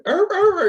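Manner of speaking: high, loud yelps of a hurt dog; dog yelping in pain. Retold or imitated. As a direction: yelp like a dog shot in the paw. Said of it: "he's hurt and he limps out".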